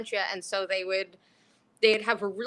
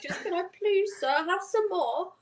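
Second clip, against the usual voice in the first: put-on pleading like a little kid; 10 semitones higher than usual. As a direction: beg like an orphan asking for more. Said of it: "about to cry like a little kid".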